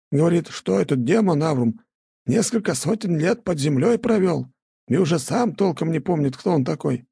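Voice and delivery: high, creaky village elder; old elder voice. A narrator's reading. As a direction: voice the old man gently. (голос старика)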